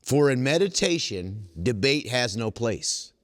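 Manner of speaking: slow weighty delivery of "debate has no place"; slow emphasis. Acted. Rereading the key line to the meeting, weighty and slow. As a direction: let each word land.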